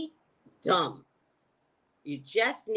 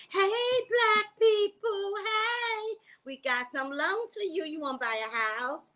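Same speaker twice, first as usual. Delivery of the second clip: sarcastic sung sales pitch, much higher; mock sales pitch; 11 semitones higher than usual. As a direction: croon it like a sleazy banker luring customers. Mocking bank's sudden loan pitch.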